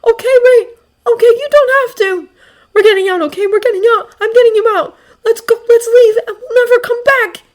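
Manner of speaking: loud, high, desperate reassurance; choked-up urgent promise. A narrator's reading. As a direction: reassure frantically, close to tears. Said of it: "he chokes out".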